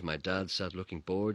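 ordinary delivery

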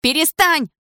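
высокий женский вскрик; крикнуть высоко, требуя прекратить; крик. A narrator's reading. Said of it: "закричала девушка". (громкий, высокий крик)

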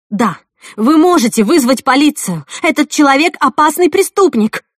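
loud, high desperate demand; frantic alarm. Acted. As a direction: cry out a warning to everyone.

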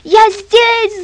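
put-on high, drawn-out boy's scream for rescue; shrill-pitched calling for help; wailing yell. A narrator's reading. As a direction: yell it high, stretching the name. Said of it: "завопил Митя".

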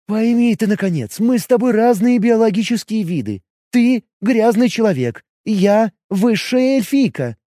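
jealous elf questioning her man; elf woman voice. Acted. Elf lecturing the paladin.

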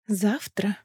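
hushed, tender single word; soft intimate promise. A narrator's reading. Lissandra promises her lover tomorrow, soft and tender. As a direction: say it softly, close to the listener.

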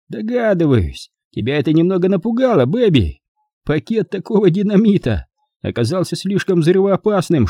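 high-pitched theatrical villain; taunting, accusing Mike. A narrator's reading.

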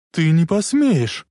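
high, loud, drawn-out protest; outraged defiance. Acted. Lorraine answering Biff's threat, outraged defiance. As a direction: cry out in disbelieving defiance.

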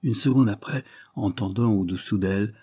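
usual speaking voice

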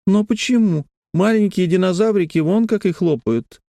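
put-on high-pitched little girl's objection; protesting child. A narrator's reading. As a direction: argue back like a child. Narrator voices girl objecting.

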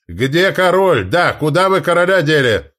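loud, high demanding shouts; crowd calling out. A narrator's reading. Duke's supporters demanding the king, calling out.

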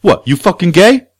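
high, loud, rising accusation; mock aggressive challenge. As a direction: imitate a stranger picking a fight. Acting out hostile stranger's challenge.

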